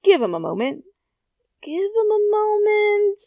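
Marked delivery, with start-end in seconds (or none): 1.6-3.2 high, squealing excitement; flustered teen squealing; excited freakout. Acted. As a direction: burst out in giddy disbelief.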